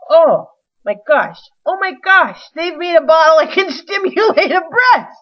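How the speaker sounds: loud, high gasping amazement; shocked excitement. Retold or imitated. Reacting in the store aisle, loud and amazed. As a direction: blurt it out in wide-eyed disbelief. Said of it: "And I'm just like".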